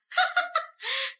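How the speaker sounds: gloating laugh. Acted. Mean girl gloating laugh.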